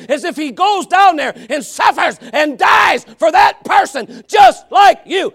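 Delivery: loud, high, building shout; preaching at full force. Real, not acted. Preacher proclaiming the sacrifice to the congregation, shouting. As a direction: preach it with everything you've got.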